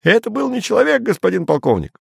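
high quick report; emboldened. A narrator's reading. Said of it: "приободрившийся Терман".

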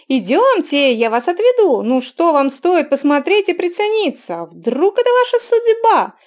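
loud, high wheedling hawker; pushy barker. A narrator's reading. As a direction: coax a reluctant customer. Barker pesters the youth.

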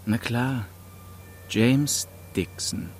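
ordinary delivery, nothing marked

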